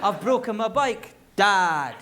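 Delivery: put-on matter-of-fact child calling Dad loudly; loud, businesslike shout for Dad; business call. Shown as a demonstration. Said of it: "it's a business call".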